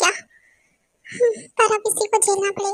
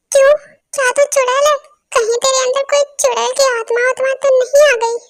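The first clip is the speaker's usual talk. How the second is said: scared shout; 3 semitones higher, 7 dB louder than usual. Acted. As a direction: yelp it out like you've seen a witch. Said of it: "अब मुझे तुझसे डर लग रहा है". (डर के मारे चिल्लाना)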